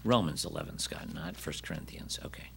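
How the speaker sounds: quick whispered correction to the tech; real whisper. Real, not acted. Aside to the slide operator.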